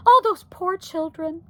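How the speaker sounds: high, loud, mildly distressed; crying out. A narrator's reading. Wife pities the children, crying out. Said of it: "cried Homley".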